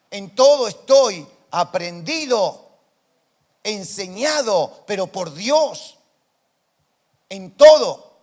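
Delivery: slow, loud, emphatic preaching; preaching shout. Real, not acted. Pastor preaching to church, slow loud climax. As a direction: build the quote to a loud climax.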